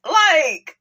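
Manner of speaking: high, loud disbelief; exasperated and fired up; incredulous outburst. Real, not acted. Outburst at ex-husband's demands.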